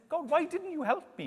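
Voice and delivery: high-pitched imitated protest; mock complaint. Man meets God in heaven, complaining in high mock protest.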